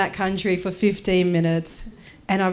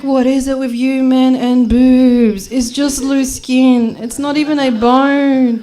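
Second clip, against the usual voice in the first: loud, slow, high protest; acted indignant rant; 5 semitones higher, 6 dB louder, slower than usual. Traveller arguing with guard over search, loud indignant rant. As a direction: stretch out each outraged phrase loudly.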